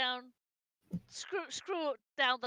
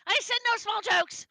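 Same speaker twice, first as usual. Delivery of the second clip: raised, higher-pitched indignant protest; indignant outburst; 7 semitones higher, 4 dB louder than usual. Acted. Irene tells off whoever called her small, indignant. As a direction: protest loudly at the teasing.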